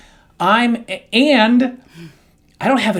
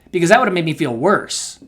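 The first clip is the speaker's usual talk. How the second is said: usual speaking voice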